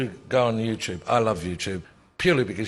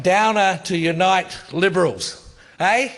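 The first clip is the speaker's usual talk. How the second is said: higher and louder mocking parliamentary jeer; imitating Keating; 9 semitones higher, 5 dB louder than usual. Telling the panel how Keating taunted Downer, mocking jeer. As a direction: ham up a gloating Keating taunt. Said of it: "Keating walks out and he goes".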